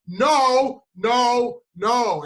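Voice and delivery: loud, high mock-tearful shouts. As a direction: yell each no like a tantrum. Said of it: "getting louder and more emotion".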